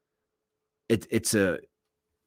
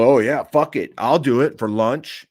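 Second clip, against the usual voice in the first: higher and louder mock-eager yes, slowing on the lunch part; sarcastic mock eagerness; 5 semitones higher, 6 dB louder than usual. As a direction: fake enthusiasm to mock a lame offer. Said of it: "that's such a great incentive for me to just go".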